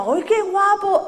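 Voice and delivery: mother's genuine, slow, high "¡Ay, qué guapo!" with a drawn-out qué; real admiration, not politeness; sincere delight. Retold or imitated. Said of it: "es que era guapo".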